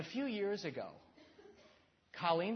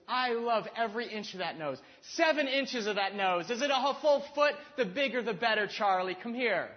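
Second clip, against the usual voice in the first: Daryl Hannah's adoring lines; 6 semitones higher, 8 dB louder than usual. Retold or imitated. Retelling Roxanne's balcony scene, Daryl Hannah's character gushing over his nose. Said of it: "She goes".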